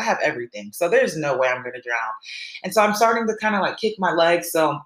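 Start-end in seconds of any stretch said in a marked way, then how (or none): none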